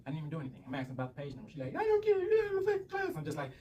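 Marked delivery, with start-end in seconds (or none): 1.8-3.1 high-pitched, loud mocking shriek; mock teacher yelling. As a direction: imitate an irate teacher shouting a student down. Imitates teacher shouting back at student.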